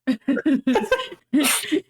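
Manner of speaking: short laugh that falls away; burst of laughter. Real, not acted. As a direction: laugh at your own joke. Laughs at her own joke.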